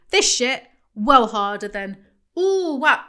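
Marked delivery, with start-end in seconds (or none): 2.4-3.0 hammed-up product delight, louder and higher; mock gushing. Mocking influencers selling a product, hammed-up gushing. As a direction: fake wow for the camera. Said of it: "over-exaggerating how much you love a product".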